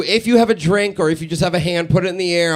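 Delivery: ordinary talk, nothing marked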